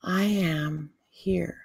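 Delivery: slow, soft and low after a breath; hushed, drawn-out calm, pitched low; still inner voice. Acted. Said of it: "we take a breath and we listen. It's like".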